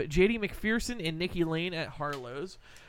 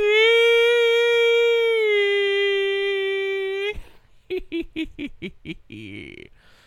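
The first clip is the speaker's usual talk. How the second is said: loud, high, long cackle; long high laugh; 11 dB louder than usual. Real, not acted. After the drawn-out bit.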